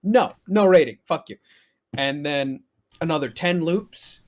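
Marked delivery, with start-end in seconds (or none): 0.0-1.4 loud scornful brush-off; annoyed refusal. Retold or imitated. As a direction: refuse it loudly with contempt. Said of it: "And I go".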